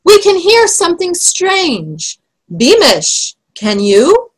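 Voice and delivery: high, restrained calling voice; shouted call. A narrator's reading. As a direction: call anxiously across the fog, pitched high. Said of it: "shouted Spittleworth".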